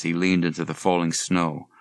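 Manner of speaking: ordinary delivery, nothing marked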